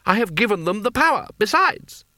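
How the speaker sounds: high, imperious outburst; commanding boy wizard's cry; shouted. A narrator's reading. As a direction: declaim it at a high, insistent pitch. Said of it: "shouted Coin".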